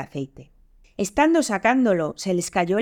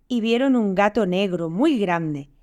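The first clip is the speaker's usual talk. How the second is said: ordinary delivery, nothing marked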